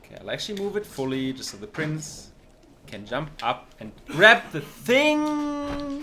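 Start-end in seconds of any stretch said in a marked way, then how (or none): 4.1-6.0 long, high, loud yell at the game; shouting. Real, not acted. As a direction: yell it, dragging out the last word high. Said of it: "Stop shouting".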